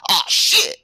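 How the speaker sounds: sudden high loud curse; startled yell. Acted. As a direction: yelp as flames catch you. Mechanic catches fire, yelling.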